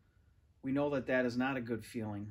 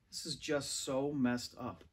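plain reading voice

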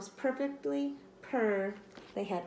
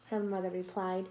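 plain reading voice

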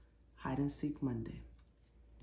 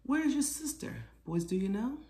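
high, sing-song question; playful question; 8 semitones higher than usual. Acted. Mother asks her sons where sister hides, sing-song. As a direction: ask like a game.

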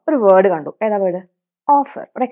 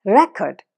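Record with the first part റെ hit; record, weight on റെ; stress on RE; 3 semitones lower than usual. Shown as a demonstration. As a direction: say record as RE-cord, noun. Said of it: "അതിനാണ് stress".

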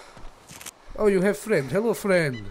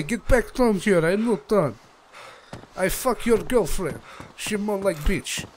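ordinary delivery

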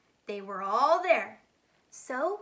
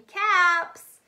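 loud, high street call; 5 semitones higher, 7 dB louder than usual. A narrator's reading. Peddler calling out his caps for sale, loud. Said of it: "he walked back to town calling".

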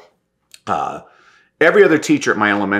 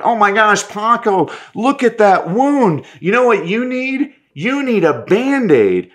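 put-on much higher, big bright sing-song to a child; animated teacher voice; 11 semitones higher, slower than usual. Teacher fussing over Paco's scraped knee, high and animated. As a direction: play up the wound to wow the class.

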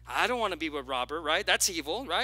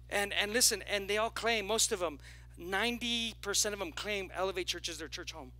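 ordinary delivery

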